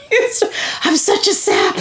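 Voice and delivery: high, wobbly laugh through happy tears; choked up, then laughing it off; teary, laughing at herself. Real, not acted. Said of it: "I'm getting all teary".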